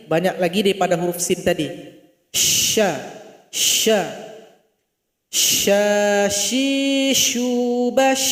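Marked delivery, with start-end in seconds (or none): 2.3-8.3 "Sya" with a long airy sh, more breath than sin; shin with more air. Shown as a demonstration. Teacher showing students the breathy shin letter. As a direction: push lots of air through "shi, shu, bash". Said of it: "keluarkan banyak angin".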